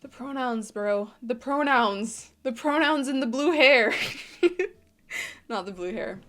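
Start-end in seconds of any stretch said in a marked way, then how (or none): 4.4-6.2 laughing. Real, not acted. Laughing aside.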